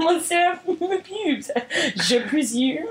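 put-on slow, hammy French accent; drawn-out mock French. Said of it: "French isn't a bad go-to".

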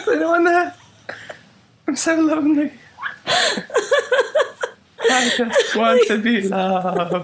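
high, plaintive mock-lonely plea; lonely little voice. Host spoofing his own timid intro, high and lonely. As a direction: squeak it out like a lost soul.

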